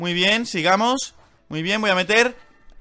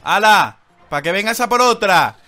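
gloating shout; 3 semitones higher, 8 dB louder than usual. Real, not acted. Scores on an enemy.

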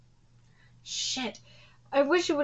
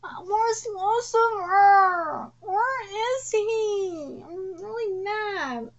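high, drawn-out pretend sob; mock whining cry; 8 semitones higher, much slower than usual. Fan missing Lucifer on screen, mock crying. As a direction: whimper it like a sulking fan. Whines for missing favourite character.

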